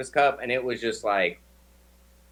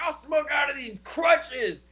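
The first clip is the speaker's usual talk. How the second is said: much higher, slow, declaring it proudly; triumphant shout; 10 semitones higher, slower than usual. Retold or imitated. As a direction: announce it like a punchline to the train. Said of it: "I was like".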